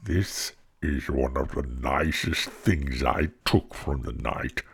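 low, slow giant voice; deep, unhurried storybook giant. A narrator's reading. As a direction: rumble it like a self-satisfied ogre. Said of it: "said the giant".

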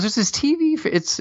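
squeaky high imitation of his wife; wife casually mentioning a TV, mimicked very high; mock wife voice. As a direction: jump way up into her voice. Retelling wife's TV pitch.

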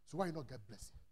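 usual speaking voice

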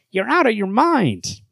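high, stretched-out incredulous hero; Superman. Acted. Hero answering Batman, high incredulous voice. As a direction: pitch it high and draw it out. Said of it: "a higher voice for Superman".

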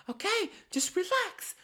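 very high soothing puppet voice; calm her down in a squeaky voice; squeaky coaxing voice. Retold or imitated. [name] calming the mother, high squeak. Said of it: "[name] is like".

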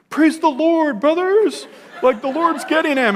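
mock praise. Rehab guys high-fiving while he cries, in a high mock cheer. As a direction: lampoon the guys celebrating.

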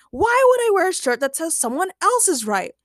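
high, indignant character voice; Ray shouting. A narrator's reading. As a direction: argue back in a high exclaiming voice. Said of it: "shouted Ray".

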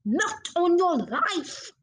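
high, drawn-out refusal; defiant shout. A narrator's reading. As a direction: stretch out each word emphatically. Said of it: "he shouted".

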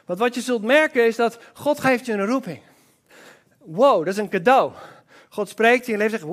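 ordinary talk, nothing marked

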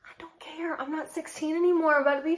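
soft unvoiced sassy whisper; whispered honey, I don't care. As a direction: breathe it like a shrug at the haters. Sassy dismissal of haters.

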